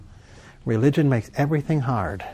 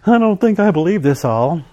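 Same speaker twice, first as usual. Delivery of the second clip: mock doubter, pitched higher; doubtful; 5 semitones higher than usual. Preacher imitates someone journaling without faith, mock doubtful. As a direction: voice a half-hearted believer's doubt.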